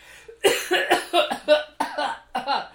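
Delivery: loud acted coughing fit; smoke coughing. Game master as man with exploded camera, coughing on smoke. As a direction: hack and cough on smoke, hammed up. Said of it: "he's coughing the smoke away".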